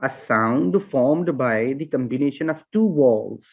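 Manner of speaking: slow, careful read of the definition; slow dictation. Shown as a demonstration. Teacher reads the definition slowly to students, carefully. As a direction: read each word out slowly for the class. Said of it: "definition भी Google से यहाँ पे हमने लिखी हुई है".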